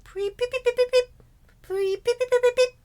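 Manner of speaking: high chirpy beeps mimicking a bird; bird chirp imitation. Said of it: "the bird outside is like".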